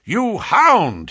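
loud, high outraged shout; angry cry. A narrator's reading. Young chap threatens the man who insulted a girl, angry cry. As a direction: cry it out hot with anger. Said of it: "he cried".

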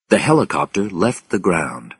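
usual speaking voice

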